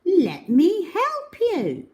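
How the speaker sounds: high eager offer, drawn out; eager little helper. Acted. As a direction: offer help in a high, bright squeak. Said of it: "she said".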